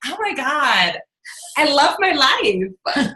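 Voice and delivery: hammed-up bliss; mock gushing. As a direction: fake delight for the camera. Fake happy Facebook post.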